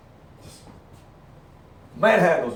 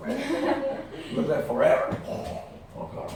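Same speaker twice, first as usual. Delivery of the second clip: words through real laughter; breathless laughing aside; 9 semitones higher, 4 dB quieter than usual. Real, not acted.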